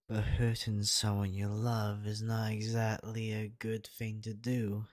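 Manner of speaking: ordinary delivery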